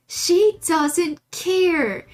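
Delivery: stressing how little the dog cares; slow emphatic repeat. Real, not acted. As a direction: stretch each word for emphasis.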